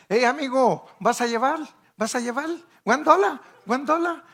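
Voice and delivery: high, loud hawking vendor voice; swap meet vendor. Retold or imitated. Preacher imitating a swap meet vendor calling to buyers, loud and high. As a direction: call out to shoppers like a market seller. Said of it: "y los coreanos".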